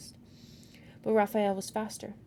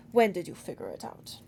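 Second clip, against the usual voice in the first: put-on demanding, accent faded; 3 semitones lower, 4 dB louder than usual. A narrator's reading. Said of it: "he demanded".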